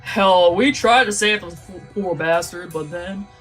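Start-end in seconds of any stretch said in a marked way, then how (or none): none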